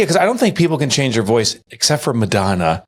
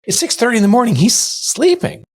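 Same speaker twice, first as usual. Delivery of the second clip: a hint of hushed pleading, higher; mock urgent hush; 12 semitones higher than usual. Husband hushing wife about sleeping son, mock plea. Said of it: "I'm like".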